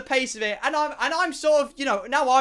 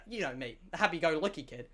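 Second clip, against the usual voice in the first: ordinary talk, nothing marked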